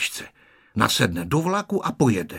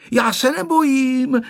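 put-on high, louder boyish boast; puffed-up bravado; 5 dB louder than usual. A narrator's reading. Honzík told he'll travel alone, puffed-up bravado. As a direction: declare it proudly in a high child's voice. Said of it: "vypjal se Honzík".

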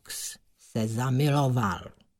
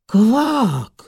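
high, loud astonished croak; cry of amazement; 9 semitones higher, 7 dB louder than usual. A narrator's reading. Suitor sees blue sky on the water, amazed cry. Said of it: "vykřikl podivením".

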